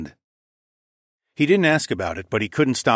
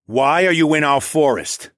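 louder, higher-pitched angry demand; 5 semitones higher, 5 dB louder than usual. A narrator's reading. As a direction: demand an answer from the intruder. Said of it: "His tone changed from angry".

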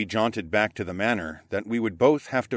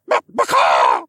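louder, slow bird squawk; 4 semitones lower, 10 dB louder, slower than usual. A narrator's reading. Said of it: "Igoric squawked".

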